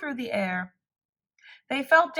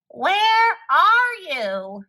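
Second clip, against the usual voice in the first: higher, louder, slow drawn-out call; cried; 8 semitones higher, 6 dB louder, much slower than usual. A narrator's reading. Toad covered in ice cream calling for Frog, slow loud call. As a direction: call across to a friend. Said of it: "cried the thing".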